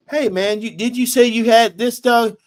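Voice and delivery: a hint of a nosy eavesdropper; mock call-out. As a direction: imitate a man butting into a conversation. Retold guy calling out stranger.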